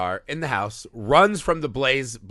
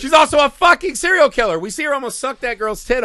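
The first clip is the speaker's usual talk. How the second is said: much higher and louder, disbelieving; incredulous outburst; 10 semitones higher, 7 dB louder than usual. Real, not acted. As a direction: blurt it out in amazement. Outraged recap of a plot twist.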